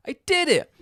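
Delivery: high, loud "I did it" in mock triumph; cheering for himself after the climb; triumphant cheer. Gamer retelling his climb, a loud triumphant cheer.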